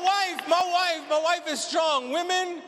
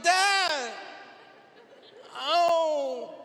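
a hint of a whiny teen groan; dramatic daughter groan.

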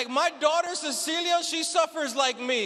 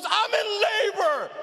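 slow, higher, strained snap with a laugh; wife in labor snapping; 5 semitones higher than usual. Retold or imitated. Wife in labor snapping at husband, strained mock yell. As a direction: snap back through labor pain.

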